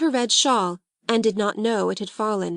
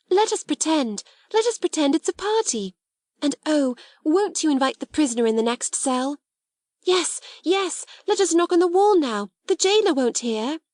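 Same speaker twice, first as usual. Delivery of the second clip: put-on high, eager girlish voice; excited make-believe; 7 semitones higher than usual. Two girls plan a secret party, excited. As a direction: conspire happily over a pretend game.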